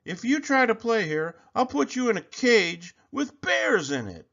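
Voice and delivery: high bully voice threatening; bully threat. A narrator's reading. Bully Sammy threatening Kevin with bears. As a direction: threaten him like a playground tyrant.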